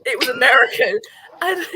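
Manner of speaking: loud, laughing words. Real, not acted. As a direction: laugh through it. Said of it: "laughing while telling".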